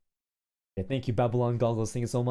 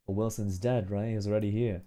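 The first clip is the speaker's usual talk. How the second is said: ordinary talk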